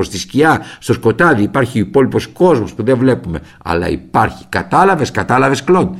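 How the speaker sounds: very irritated shout. Retold or imitated. Painter yelling at his pestering son, loud and exasperated. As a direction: hammer every εδώ home, furious. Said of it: "λέγοντάς μου πολύ εκνευρισμένος".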